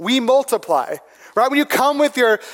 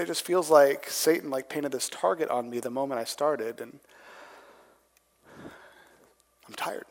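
soft, low retold weariness; weary confession; 8 semitones lower, 10 dB quieter than usual. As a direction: quote a worn-out leader, low and hushed, trailing into near silence. Voicing a worn-out church leader.

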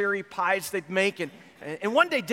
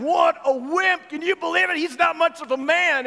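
laughing jeer; 8 semitones higher, 5 dB louder than usual. Retold or imitated. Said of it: "The guys are just laughing at him".

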